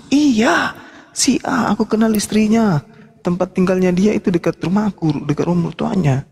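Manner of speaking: high-pitched retold exchange; acted-out insistence. Acts out employee's startling revelation.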